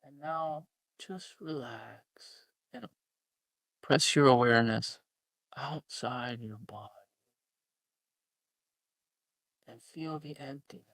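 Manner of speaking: hushed spiritual teacher, hammed-up; sending up a soft-voiced meditation teacher; mock breathy guru. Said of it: "say in a breathy voice".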